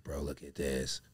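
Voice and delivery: whispered brother; hushed unvoiced bro line. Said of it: "He's like".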